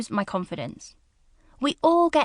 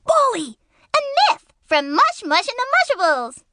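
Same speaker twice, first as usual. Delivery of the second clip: squeaky cartoon voice; 12 semitones higher, 3 dB louder than usual. Shown as a demonstration. Voice reel of character voices.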